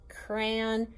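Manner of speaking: ordinary talk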